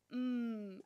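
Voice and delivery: "m" as a sustained lip hum; isolated phoneme. Shown as a demonstration. Teacher recalling a gesture already shown, "m" hummed long. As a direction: hold "m" with closed lips, no vowel. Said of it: "je l'avais montré".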